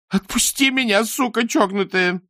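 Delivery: high furious shout, slowing on the insult; angry shout. A narrator's reading. Tied-up mother screams at her captor in fury.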